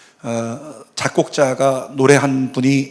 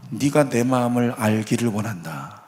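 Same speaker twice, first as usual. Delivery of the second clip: soft, hushed and lower, almost tender; a quiet divine whisper-like reassurance; gentle voice of God; 4 semitones lower, 7 dB quieter than usual. Retold or imitated. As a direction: speak it low as a father to his daughter. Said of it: "하나님이 이렇게 말씀하셨대요".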